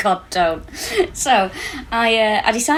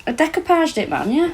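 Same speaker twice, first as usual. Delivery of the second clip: higher, proud and emphatic; proud high exclaim; 8 semitones higher than usual. Real, not acted. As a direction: say it with mock pride. Proudly confirming the craft.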